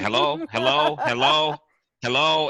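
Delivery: pretending he can't hear, calling hello; mock faked bad connection. Imitating Mike faking a dropped call to dodge the question, high. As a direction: fake hello into a dead line, high. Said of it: "he's faking".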